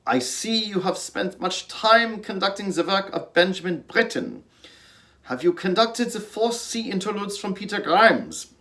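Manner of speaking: high, imitated heavy Dutch accent. Apprentice retells his Dutch music director's question in heavy Dutch accent. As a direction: quote the maestro in a thick Dutch accent. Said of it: "he said in that heavy Dutch accent".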